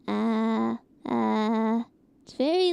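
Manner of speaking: ordinary delivery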